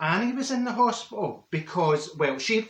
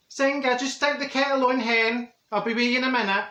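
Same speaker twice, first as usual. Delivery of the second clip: higher, carrying call toward the kitchen; 7 semitones higher, 3 dB louder than usual. Acted. Calling to Senga in the kitchen, raised call. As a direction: call through the house to Senga, hen.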